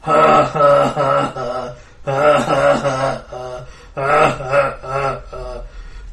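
loud booming villain laugh; confident troll laugh. Acted. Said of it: "laughs very confidently".

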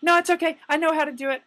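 lightly voiced girl insisting; insistent customer. Customer refusing the clerk's help, high and insistent. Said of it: "she was like".

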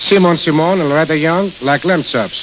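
ordinary talk

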